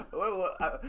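flustered coach, through laughter; imitating Coach Dan. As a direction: stammer like a rattled coach. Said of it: "Coach Dan was like".

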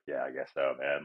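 low, soft mock resignation; imitated reluctant agreement. Friend agreeing to follow a stranger down an alley, reluctant. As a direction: give in half-heartedly, low and soft.

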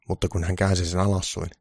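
usual speaking voice

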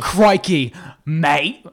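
hammed-up Crikey accent, rising at the end; put-on Crikey accent. Shown as a demonstration. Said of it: "What's the best accent you could do".

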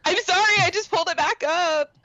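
high, loud comic sorry; squealed defensive apology; protesting apology. Real, not acted. Protesting after being teased for late correction.

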